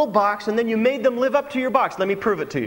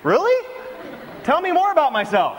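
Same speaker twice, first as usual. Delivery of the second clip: sarcastic reply; 8 semitones higher than usual. Retold or imitated. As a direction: feign wide-eyed interest, dripping with irony. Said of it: "And I'm like".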